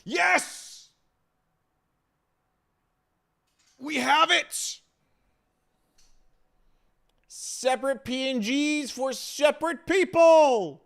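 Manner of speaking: high, loud triumphant yell; cheer, it finally works; excited shout. Real, not acted.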